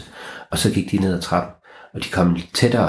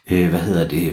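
usual speaking voice

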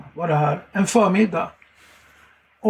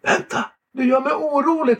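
shaken pleading; 6 semitones higher than usual. Retold or imitated. Shaken man on the phone.

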